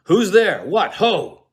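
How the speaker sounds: startled loud cries; calling out. Acted. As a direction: shout into the dark to see who's there. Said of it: "Macbeth calls out".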